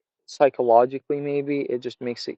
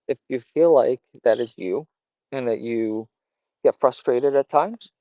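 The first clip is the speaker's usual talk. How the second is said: ordinary talk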